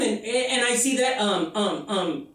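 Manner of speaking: normal talking voice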